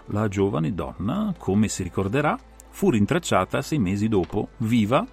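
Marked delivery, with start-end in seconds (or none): none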